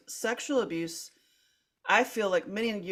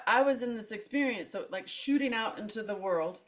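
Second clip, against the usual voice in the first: ordinary talk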